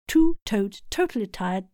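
normal talking voice

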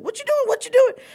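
very high, loud alarmed protest; standoffish. Retold or imitated. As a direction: fend them off in a loud squeal. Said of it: "it was very standoffish".

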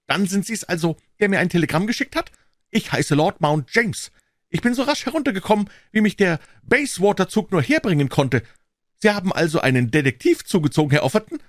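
put-on high, loud, sharp old voice; indignant old man. A narrator's reading. Old man confronting Holmes over the papers, high and indignant. Lord Mount James speaks.

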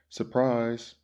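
loud, high announcement; calling out. A narrator's reading. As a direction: call it out to someone behind a door. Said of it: "he called".

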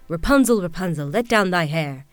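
loud call up the tower; prince calling out. Acted. Prince calling up the tower for Rapunzel, loud. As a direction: shout her name up to the window. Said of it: "the king's son came and cried".

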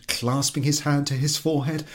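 usual speaking voice

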